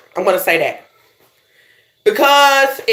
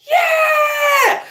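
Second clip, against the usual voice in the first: high, drawn-out cheer; long high-pitched whoop; hyped crowd answer. Acted. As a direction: model an eager audience hollering back. Prompts listeners to answer like crowd.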